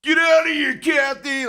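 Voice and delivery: put-on loud, high New York accent yell; New York heckler. New York fan heckling the mayor, loud New York accent yell. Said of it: "New Yorker in a New York accent".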